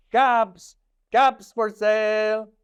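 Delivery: high, loud peddler's call; calling out wares. A narrator's reading. Peddler calls to the street, high loud cry. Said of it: "walked back to town calling".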